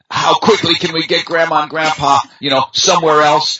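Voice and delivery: mock the impatience of grown children; mocking modern families.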